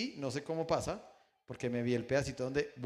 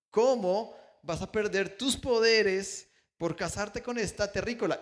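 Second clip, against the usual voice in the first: slow, louder and higher scolding father; disapproving father; 6 semitones higher, 5 dB louder than usual. Retold or imitated. Father scolding his son for marrying a human, slow and louder. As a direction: rebuke the son for throwing away his powers.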